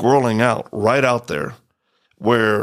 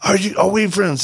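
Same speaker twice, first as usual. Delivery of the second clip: higher, pushed retold yell; mock yell; 8 semitones higher than usual. Yelling to the hard-of-hearing soldier, a mild mock yell. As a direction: raise it over him as if he can't hear. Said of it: "we're yelling at this point".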